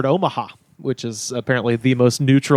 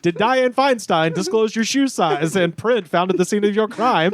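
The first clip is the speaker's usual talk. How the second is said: put-on mid-Atlantic accent; 6 semitones higher than usual. Old-timey ad bit.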